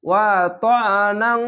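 ওয়া ত্বআনাং ending in a long nasal "ng"; ikhfa ghunna. Shown as a demonstration. Teacher showing learners ikhfa, drawn-out nasal ending. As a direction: hold the end of ওয়া ত্বআনাং as a nasal hum. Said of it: "ইকফা গুন্না হবে".

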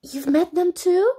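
surprised. A narrator's reading. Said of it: "asked Alby".

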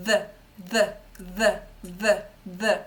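«th» repeated, tongue tip flicked off the upper teeth; short energetic flicks of the voiced th; voiced th drill. Shown as a demonstration. Teacher shows students how to flick the voiced th. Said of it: "йде видих у цей момент".

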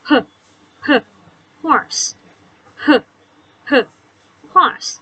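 h h breathed out before horse; teacher sounding out the h sound; phonics sound. Shown as a demonstration. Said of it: "เสียงเหมือนฮอนกฮูกนะจ๊ะ".

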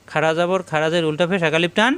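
ordinary talk

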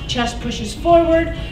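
ordinary talk, nothing marked

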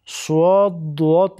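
سواد، دوات with a w glide, "su-od, du-ot"; added waw sound. Shown as a demonstration. Teacher quoting wrong letter names, inserted w glide. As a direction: stretch سواد and دوات with an extra u.

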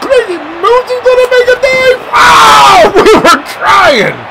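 high, loud excited play call; high excited yell breaking into a laugh; excited shout. Real, not acted. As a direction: shout as the diving catch fails, then laugh. Diving catch attempt.

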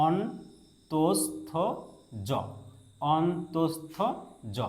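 অন্তস্থ very slowly as on, tos, tho, then জ; teacher dictating অন্তস্থ জ piece by piece; slow syllabification. Shown as a demonstration. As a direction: stretch অন্তস্থ with gaps between syllables. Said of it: "ভালো করে উচ্চারণটা".

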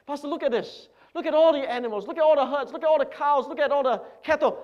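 loud, high bragging over his land; a proud landowner crowing over his wealth; boastful rich man. Acted. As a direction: show off every field you own. Boaster shows pastor his wealth.

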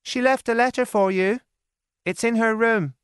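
put-on a young maid breaking news; Lizzie's voice. A narrator's reading. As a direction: servant girl telling sad news. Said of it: "Lizzie said".

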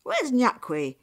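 loud, high demand; angry father. A narrator's reading. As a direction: demand to know where she is. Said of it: "He was angry".

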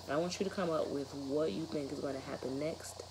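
plain reading voice

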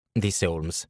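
plain reading voice

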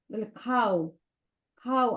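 normal talking voice

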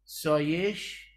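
سایش، drawn out very slowly; slowed demo word. Shown as a demonstration. Teacher stretching the word for students, very slow. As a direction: stretch سایش، to show the friction. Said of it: "وی دسته دار چی هن؟".

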